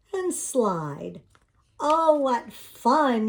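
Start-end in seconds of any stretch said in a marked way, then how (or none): 1.8-3.3 slow, bright and pleased; smiling. A narrator's reading. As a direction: say it with a big smile. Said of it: "he said with a smile".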